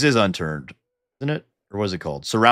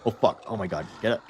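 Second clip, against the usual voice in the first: normal talking voice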